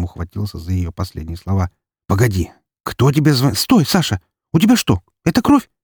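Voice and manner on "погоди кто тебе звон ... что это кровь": put-on high, panicked young sister voice; sudden fright at the blood; alarmed sister. Sister sees his blood.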